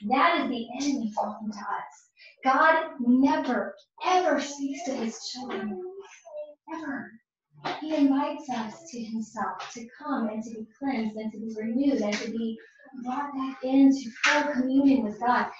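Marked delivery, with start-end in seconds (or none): none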